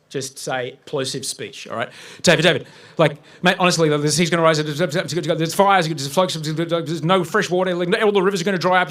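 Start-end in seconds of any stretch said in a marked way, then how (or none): 2.2-8.9 loud, frantic, tumbling out of control; phoning his mentor, loud and terrified; panicked pleading. Retold or imitated. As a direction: beg your mentor in pure fear. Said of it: "spike of pure fear in my voice".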